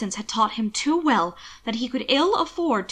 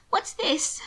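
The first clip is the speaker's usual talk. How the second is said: higher, tearful question; thick with tears; 7 semitones higher than usual. A narrator's reading. Sister asks about the letter, voice thick with tears. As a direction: ask it through held-back tears. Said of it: "voice thick with tears".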